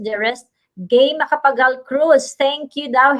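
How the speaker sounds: ordinary delivery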